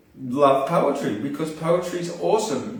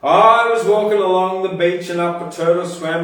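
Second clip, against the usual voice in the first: louder, lively spoken-word rhythm; poem recital; 3 semitones higher, 4 dB louder than usual. Acted. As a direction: perform it to the crowd with energy.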